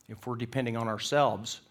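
ordinary talk, nothing marked

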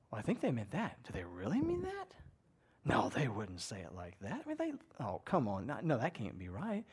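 spiral through doubts, second-guessing every word; anxious inner voice. Retold or imitated. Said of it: "and you're going".

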